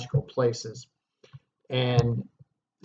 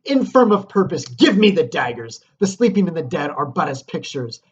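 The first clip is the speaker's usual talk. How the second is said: loud, higher scornful command; annoyed, scornful; 3 semitones higher, 7 dB louder than usual. Acted. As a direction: call him a coward as you snatch the daggers. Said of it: "acts annoyed and she basically calls him a coward".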